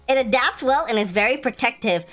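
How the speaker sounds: ordinary talk, nothing marked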